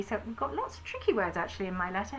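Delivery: normal talking voice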